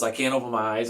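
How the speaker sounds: ordinary talk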